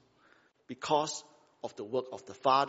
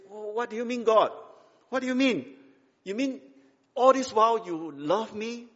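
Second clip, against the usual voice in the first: higher-pitched disbelieving questions; incredulous; 7 semitones higher, 4 dB louder than usual. Acted. Scolded child questioning God's love, incredulous. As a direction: challenge it as too good to be true. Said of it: "it always sounds very incredulous".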